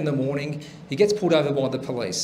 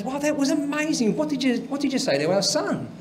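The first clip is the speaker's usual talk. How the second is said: high-pitched put-on voice of an impressed wife; imitated amazed wife; 8 semitones higher, 4 dB quieter than usual. Joke teller as wife praising husband, imitated amazement. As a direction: lift the voice high in mock amazement.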